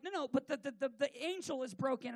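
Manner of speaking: lightly hammed-up fluster; retold panic. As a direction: stumble over the words in acted alarm. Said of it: "Because I'm still panicking".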